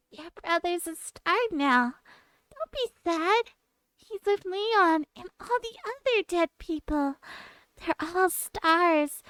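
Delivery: high, slow, sugary taunting voice; mocking taunt. Player imitating Regina taunting Acro, slow sugary mockery. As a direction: mimic a cruel cheerful girl. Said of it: "she was taunting him like".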